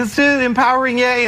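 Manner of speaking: high, hammed-up parent cheer; mock cheer. Comic dad imitating parents cheering at soccer, hammed-up high. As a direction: squeal it like a forced sideline parent.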